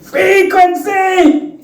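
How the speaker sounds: very high, long-drawn "Frequency!"; mock cursing yell. Shown as a demonstration. Speaker shows off the substitute F word, high mock yell. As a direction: cry out "Frequency" like an expletive, stretched and high-pitched.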